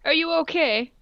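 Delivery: ordinary talk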